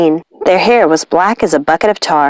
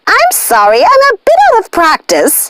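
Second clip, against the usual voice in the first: a lightly higher apologetic witch voice; mildly higher witch apology; sheepish witch; 12 semitones higher than usual. Acted. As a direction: shrug off the mistake a little high. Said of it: "gave her sister a disappointed look".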